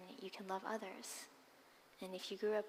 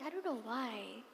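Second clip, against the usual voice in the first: ordinary delivery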